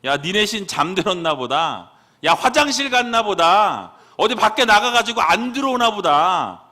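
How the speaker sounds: mock the false prophets, building to a loud jeer; mocking taunt. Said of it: "조롱하기 시작해요. 놀리기 시작합니다".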